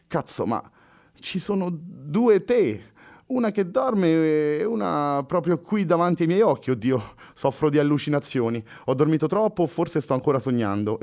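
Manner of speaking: Henry waking up and seeing double; stunned Frenchman. Acted. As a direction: gape at the double vision.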